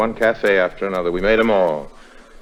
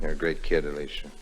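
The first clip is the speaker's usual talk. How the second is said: softer, lower, tender voice; 3 semitones lower, 8 dB quieter than usual. Acted. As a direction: say it close and fond. Tender moment after kiss.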